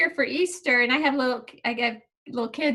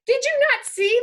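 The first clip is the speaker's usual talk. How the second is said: much higher disbelieving ask; incredulous question; 10 semitones higher, 3 dB louder than usual. Retold or imitated. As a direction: sound stunned they missed it. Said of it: "I'm like".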